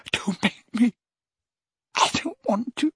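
high, pleading and afraid; beg, terrified; frightened pleading. A narrator's reading. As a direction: Dumbledore begging Harry. Dumbledore pleading.

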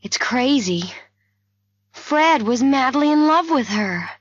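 high, heated objection; protest, pitch climbing; disbelieving protest. A narrator's reading. Narrator refusing to believe Fred killed Eleanor, protesting.

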